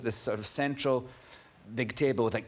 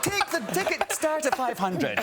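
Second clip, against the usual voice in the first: laughing plea; 14 semitones higher than usual. Retold or imitated. Laughing retelling his own plea.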